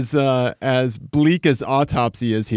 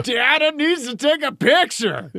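strained, much higher comic impression; Kyle Kinane voice; 12 semitones higher than usual. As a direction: push it up into a hammy comedian's voice. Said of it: "I go into Kyle Kinane voice".

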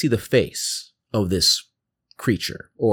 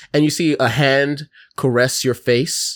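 ordinary delivery

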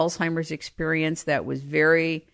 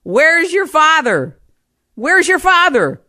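high, loud, slow pleading repeat; a confused mother asking again and again; 11 semitones higher, 8 dB louder than usual. Retold or imitated. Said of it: "my mother would turn to me and say".

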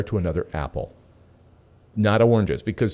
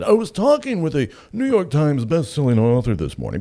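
higher and louder, mock pompous name-drop; pretentious; 6 semitones higher, 4 dB louder than usual. Shown as a demonstration. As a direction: puff it up like a self-important name-dropper. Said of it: "Is there anything more pretentious than starting a sentence with that?".

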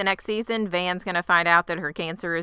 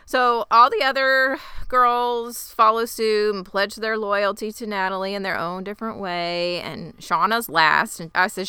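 ordinary delivery

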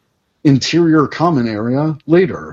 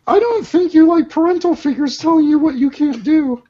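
put-on high girl voice; 12 semitones higher than usual. Voicing teen character.

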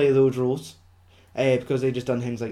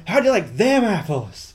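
higher, louder smug movie line; gloating taunt; 6 semitones higher, 4 dB louder than usual. Retold or imitated. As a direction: deliver it as the triumphant zinger. Acts the famous line in character.

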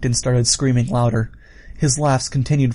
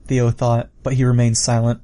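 ordinary delivery

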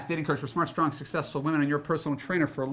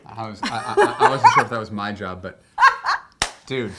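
laughing; 11 dB louder than usual. Real, not acted. Host laughing at joke.

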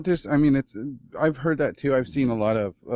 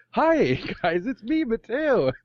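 high, louder, slow chirpy greeting; future Alex voice; 12 semitones higher, 4 dB louder, slower than usual. Retold or imitated. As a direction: say Mateo like a cheery time traveller. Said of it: "in that future Alex voice".

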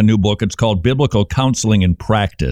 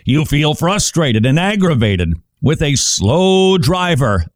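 normal talking voice

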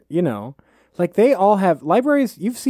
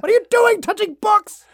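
high, far-off scolding squeak; 3 dB louder than usual. Pretend. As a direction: shriek at a book toucher. Mock scolding librarian.

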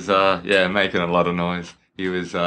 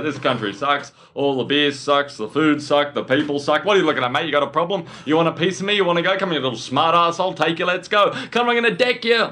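rising, ever higher mock drunk Aussie rant; drunken Aussie rant; 5 semitones higher than usual. Retelling an Australian tourist abusing Americans, building mock aggression. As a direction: build the rant from whinge to picking a fight. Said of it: "He's like".

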